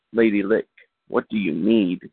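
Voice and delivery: ordinary delivery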